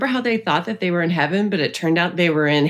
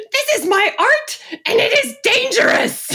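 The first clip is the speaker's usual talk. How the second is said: imitating a film diva, very high and slow; high theatrical proclamation, each word drawn out; mock fierce conviction. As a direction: declaim it with hammed-up conviction. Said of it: "she says it with such conviction".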